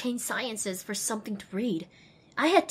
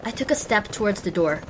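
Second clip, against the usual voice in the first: usual speaking voice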